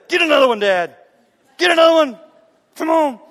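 cheering on a tired dad; son urging loudly. Retold or imitated. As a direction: push him on like an excited gym buddy.

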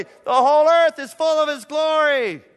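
high, booming proclamation; full-voiced exultant cry; shouting. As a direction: shout it out like angels calling across heaven. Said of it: "they're shouting back and forth".

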